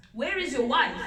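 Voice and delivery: loud demanding king's roar. Acted. Lion roaring at Kudu who came alone. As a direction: bellow the question at Kudu. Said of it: "roared the lion".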